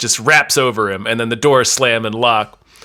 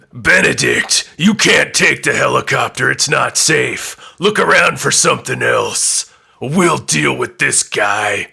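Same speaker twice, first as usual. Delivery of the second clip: stern warning. Acted. Kurgan warns Benedict.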